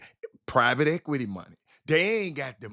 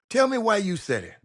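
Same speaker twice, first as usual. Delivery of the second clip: ordinary talk, nothing marked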